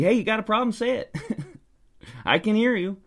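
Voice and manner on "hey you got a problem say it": high, taunting challenge; mock confrontational. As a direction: taunt the viewer. Mock confronting opening lines.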